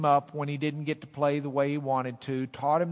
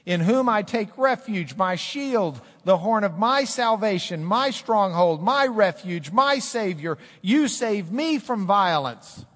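loud, high proclamation of the psalm; full-force preaching; 7 semitones higher, 8 dB louder than usual. Acted. Pastor declares David's psalm to the church, preaching loud. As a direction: proclaim every word to the back row.

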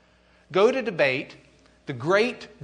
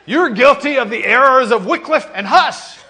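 much louder, higher laughing accusation; accusing shout; 10 semitones higher, 14 dB louder than usual. Pretend. Teacher repeating Eck's accusation to Luther, loud and laughing. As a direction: hurl the charge again.

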